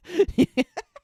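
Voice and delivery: sharp high shriek of laughter; loud high laugh. Real, not acted. Laughing about his shin.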